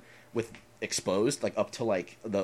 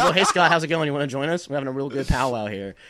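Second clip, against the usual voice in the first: laughing; 5 semitones higher, 14 dB louder than usual. Retold or imitated. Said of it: "Shane just goes".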